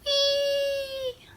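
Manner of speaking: very high, soft, held wee; squeal of joy. Acted. A belly flop into the water, a high happy squeal. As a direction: float it up like a kid sliding.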